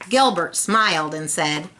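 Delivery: plain reading voice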